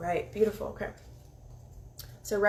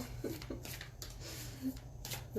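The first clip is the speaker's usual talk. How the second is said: soft giggle; 5 semitones higher, 14 dB quieter than usual. Real, not acted. Giggling after stuttering.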